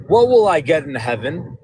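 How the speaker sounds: ordinary talk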